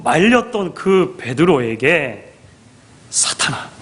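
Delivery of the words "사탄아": whispered, stern address; dramatic hushed accusation; hushed rebuke. Acted. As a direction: drop to a whisper to name the offender. Preacher re-enacts the rebuke, hushed.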